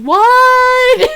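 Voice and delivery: high, loud shocked yelp; shocked kids. Retold or imitated. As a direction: squeak it in disbelief. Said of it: "They're like".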